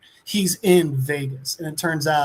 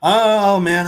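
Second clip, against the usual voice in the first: mock lament; 4 semitones higher, 7 dB louder than usual. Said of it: "And he's like".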